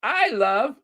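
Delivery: high-pitched British imitation; hammed-up Brit, pitched high; mock British accent. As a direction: try out a playful British "I love". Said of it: "you can't say the I".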